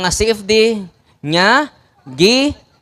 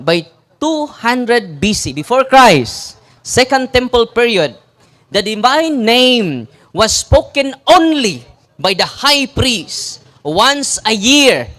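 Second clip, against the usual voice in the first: slow emphatic reading; 3 semitones higher, slower than usual. Shown as a demonstration. Reading history slowly for emphasis.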